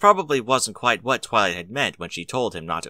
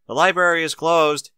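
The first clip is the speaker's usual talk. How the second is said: calling through the door, louder and higher; raised voice calling through a closed door; called out; 7 semitones higher, 5 dB louder than usual. A narrator's reading. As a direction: call out to the visitor at the door. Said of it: "he called out".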